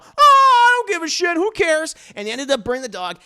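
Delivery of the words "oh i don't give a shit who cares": very high, loud dismissive squeal; mock his not-caring shrilly; mock careless butler. Mocking the careless butler, loud high scoff.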